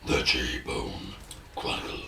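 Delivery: very deep, slow title voice; mock announcer. Co-host echoing the title, deep mock-announcer voice. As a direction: drop right down and intone it softly.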